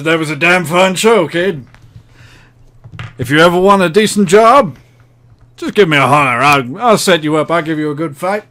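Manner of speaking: loud, brash promoter voice; gruff fight promoter. Acted. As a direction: talk like a seedy fight promoter. GM voices ring announcer.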